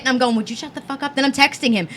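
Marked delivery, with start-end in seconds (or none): 0.4-1.1 softer, under-the-breath mock telling-off; retold hushed rebuke. Woman retelling fight beside a stranger, hushed rebuke. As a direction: keep it down so the neighbor won't hear.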